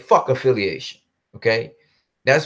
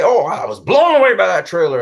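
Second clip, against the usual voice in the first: lightly voiced fake enthusiasm, a touch louder; mock gushing; 4 semitones higher, 5 dB louder than usual. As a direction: parody a fake fan. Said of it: "I'm not gonna say".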